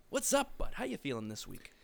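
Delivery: ordinary talk, nothing marked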